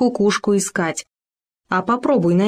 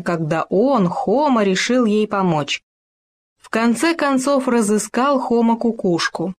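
ordinary delivery, nothing marked

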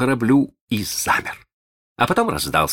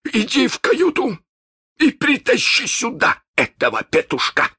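high, hard captain's command; iron command; 3 dB louder than usual. Acted. Hook orders Chako into the cabin, high and hard. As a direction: order him in without mercy. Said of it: "самым железным голосом".

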